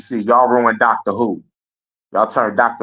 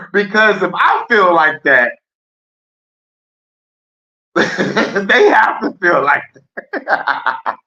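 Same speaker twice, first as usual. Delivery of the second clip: higher and louder, breaking into laughter; laughing; 9 semitones higher, 5 dB louder, slower than usual. Real, not acted. Streamer to chat, cracking up at his own theory. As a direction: laugh through the end of the thought.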